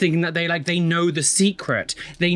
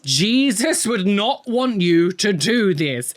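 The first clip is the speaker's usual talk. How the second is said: mock preaching; 4 semitones higher, slower than usual.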